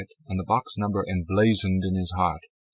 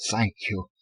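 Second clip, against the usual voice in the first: stammered thanks. A narrator's reading. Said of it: "Zell stammered".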